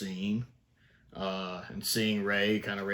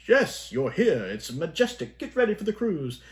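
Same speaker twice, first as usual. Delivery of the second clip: higher, louder, grand announcer flourish; hammed-up showman; 8 semitones higher, 4 dB louder than usual. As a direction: sell the cruise like an overeager showman. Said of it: "he's very much being the showman".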